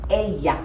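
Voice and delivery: "ella" with a slight Y sound for the double L. Shown as a demonstration. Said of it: "they have a slight Y sound".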